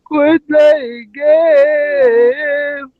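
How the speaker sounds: slow, drawn-out sobbing plea; crying voice. Acted. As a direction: stretch it out, sobbing. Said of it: "this crying voice".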